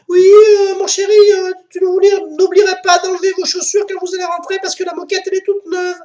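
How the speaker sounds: very high falsetto woman; high wife voice. Acted. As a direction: squeak like the wife. Wife calls back.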